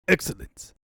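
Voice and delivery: a breathy evil hiss; villainous hiss. Acted. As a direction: savor it like a villain. Villain gloating.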